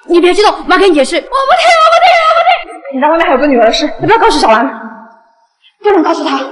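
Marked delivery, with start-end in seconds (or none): 1.2-3.0 high whining refusal; whiny child. Acted. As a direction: whine like a stubborn kid. 孩子捂耳耍赖. (撒娇耍赖的小孩)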